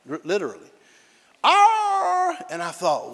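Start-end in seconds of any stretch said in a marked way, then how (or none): 1.4-2.4 high imitated yowl of distress; dying animal howl. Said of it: "sound like an animal was dying".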